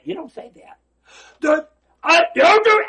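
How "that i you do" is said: high loud snapped command; trainer yell. Retold or imitated. Trainer shouting down the client's bargain, loud. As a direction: cut him off with a yell.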